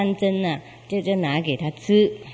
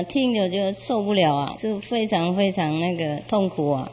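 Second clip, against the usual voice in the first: ordinary delivery, nothing marked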